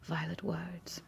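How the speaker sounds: soft breathy whisper to the camera. Real, not acted. Vlogger whispering to her viewers, hushed whisper.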